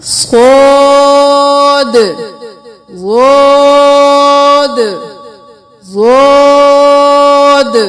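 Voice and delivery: صاد drawn out long, full and heavy in the mouth; heavy saad. Shown as a demonstration. Teacher modelling a heavy letter for pupils, full and drawn out. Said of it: "اس کو موٹا پڑھنا ہے".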